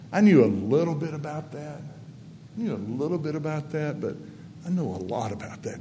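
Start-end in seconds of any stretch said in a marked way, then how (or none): 1.8-4.0 much softer, almost to himself; soft reflective echo. Real, not acted. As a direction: let the repeat drop away quietly. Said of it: "repeated softly".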